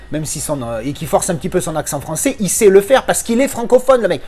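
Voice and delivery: ordinary talk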